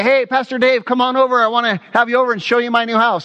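high, eager, hurrying by the end; excited invitation. Acted. New homeowner inviting the pastor over, high and excited. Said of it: "you're all excited".

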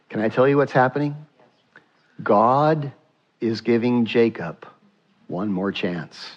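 ordinary talk

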